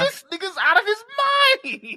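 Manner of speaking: very high-pitched incredulous outburst; exclaimed disbelief. Retold or imitated. Viewer reacting to Bishop shooting Steel, high-pitched disbelief. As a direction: let the voice leap up in shock.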